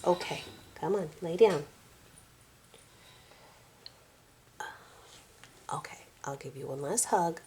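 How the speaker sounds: quiet, patient coaxing of the cat; soft pleading. Real, not acted. Owner softly begging her clingy cat to lay down.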